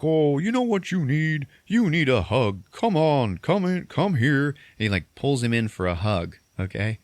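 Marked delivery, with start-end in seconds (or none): 0.0-4.5 high, drawn-out coaxing Santa; hammed-up Santa offering a hug; Santa in character. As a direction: coax slowly in a lifted voice. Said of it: "the other Santa is like".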